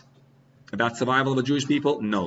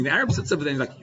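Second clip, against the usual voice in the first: ordinary talk, nothing marked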